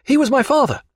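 put-on high, plain young voice; young midshipman. A narrator's reading. Midshipman telling the captain about his father, unemotional. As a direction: state it simply, without emotion. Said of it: "said the youth".

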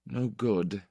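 put-on quiet and gentle, a little boyish; soft boyish voice. A narrator's reading. Estenskar after crying, soft and boyish. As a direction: let it come out small and soft. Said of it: "he said in a soft, boyish voice".

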